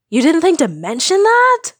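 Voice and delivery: high, loud, furious howl. A narrator's reading. Luke howling angrily at Han over the secret. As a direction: let the outrage burst out. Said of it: "he howled".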